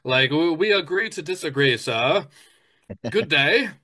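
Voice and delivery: hammed-up courteous British farewell; mock British politeness. Friend imitates British arguer agreeing to disagree, mock British accent. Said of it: "And you're like".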